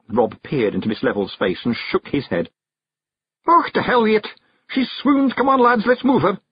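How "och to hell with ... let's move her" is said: high, loud little Scot rallying his lads; Rob Anybody, exasperated. Acted. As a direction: give up and order the lads on. Rob rallies the Feegles.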